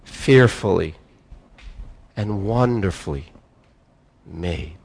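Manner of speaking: slow, tender affirmation; sincere. Shown as a demonstration. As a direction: savour each word like a confession of faith. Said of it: "Can you say that sincerely?".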